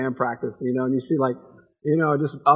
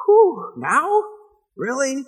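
higher, mock disbelief; incredulous Sarah; 10 semitones higher, 4 dB louder, much slower than usual. Sarah doubting her old age, higher incredulous mock. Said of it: "as she thought".

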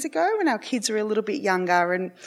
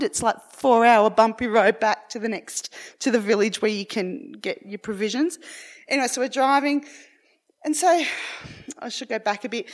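ordinary delivery

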